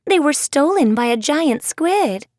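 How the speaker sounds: a brisk schoolgirl explaining; Apple's voice. Acted. Apple telling Briar about the squid, lightly voiced.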